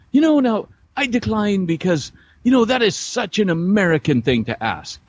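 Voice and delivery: high-pitched, condescending imitation; mock a man talking down to someone ignorant. An Englishman declines to answer the American's question, imitated condescendingly. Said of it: "talking to me like I was super ignorant".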